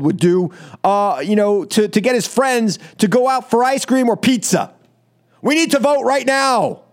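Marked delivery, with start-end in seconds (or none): 5.4-6.8 higher, whiny mock of the president's tweet; mocking a childish demand. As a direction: mimic a spoiled teen demanding now. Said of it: "he tweets out that they need to vote".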